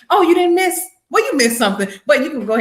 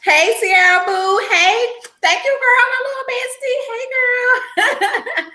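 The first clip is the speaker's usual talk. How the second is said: high-pitched gleeful hello to a friend; excited greeting; 13 semitones higher, slower than usual. Real, not acted. Host greets her bestie on the live stream, high excited greeting. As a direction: squeal it out to a bestie.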